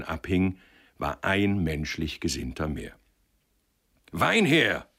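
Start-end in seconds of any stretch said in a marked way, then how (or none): 4.1-4.9 high, loud command; called order. A narrator's reading. Josef ordering wine to celebrate, called out loudly. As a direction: call heartily for wine. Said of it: "rief Josef".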